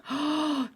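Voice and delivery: high, sharp intake of breath; excited gasp. Real, not acted. Player spotting huge coffee tables, excited gasp. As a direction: gasp with sudden delight.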